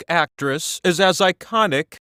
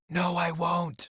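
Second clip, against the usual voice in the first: sly aside; 8 dB quieter, slower than usual. Acted. Sly aside after promising to stop.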